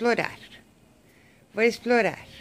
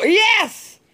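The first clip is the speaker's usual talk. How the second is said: much higher, loud yell of victory; triumphant shout; 12 semitones higher, 6 dB louder than usual. Real, not acted. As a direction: cheer like a goal. Cheering the jump.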